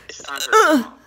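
squeaky mock calm. Soothing hiccups, squeaks.

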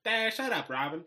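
high, twangy put-on voice; a kid doing a silly drawling Batman for his friends; odd Batman voice. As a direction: slip into a goofy country-tinged Batman. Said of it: "why are you talking like this".

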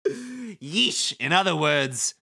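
breezy, mocking wiseguy; sly villain Kubo. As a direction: thank them with smug false gratitude. Plays Kubo reading game script.

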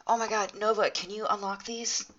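usual speaking voice

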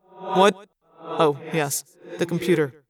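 absent start, then settling into recollection; distracted. A narrator's reading. Prince is reminded of the computer request, distractedly. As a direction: come out of a brooding reverie.

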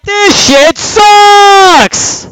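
drawn-out, high screamed complaint; exasperated yell. Retold or imitated. Player re-enacts raging at the game alone at home, screaming. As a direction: yell it out, stretching the last word. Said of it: "that was me in my house alone".

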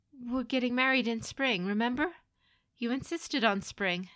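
hopeful pleading. Acted. Son reminding mother of his wedding, hopeful pleading. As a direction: coax her gently to remember.

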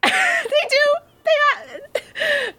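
high laughing squeak; breaking into giggles. Real, not acted.

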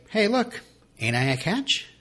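lightly acted look-at-me pitch; mock self-promotion. Boyfriend sells himself to Lorelai, mock showing off.

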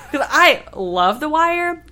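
slow, laughing through the words. Real, not acted. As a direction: still giggling with delight. Laughing, still thrilled.

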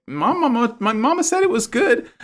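put-on high, small, naive child voice; childlike Suletta voice. Retelling the anime to a friend, high naive childlike Suletta. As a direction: play it sweet and clueless like a little kid. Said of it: "And Suletta's like".

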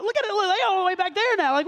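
excited. Real, not acted. Pointing out the crowd at the back.

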